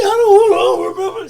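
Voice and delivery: high, loud hammed-up wail; mock crying fit. Witness asked to read her statement, mock bawling high and loud. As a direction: imitate a witness bawling out a denial. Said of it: "started a fit crying".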